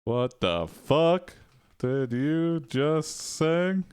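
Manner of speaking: drawn-out, slow quote, word by word; slow mock challenge, rising; troll's line dictated. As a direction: stretch each word as if typing it out. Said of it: "and says".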